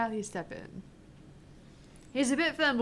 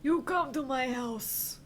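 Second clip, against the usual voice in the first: lightly voiced Italian mob boss; a touch of the Italian accent; mock Godfather; 4 semitones higher, 7 dB louder than usual. Joining in on the Godfather quote with a light Italian accent. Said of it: "more like The Godfather".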